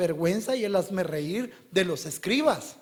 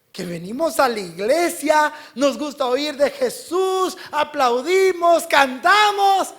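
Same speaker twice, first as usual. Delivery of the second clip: rising, slowing, ever louder preacher's list; building shout; 9 semitones higher, 8 dB louder, slower than usual. Real, not acted. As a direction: build to a high, drawn-out shout. Builds list of churchgoers' enthusiasm. (grito de predicador en aumento)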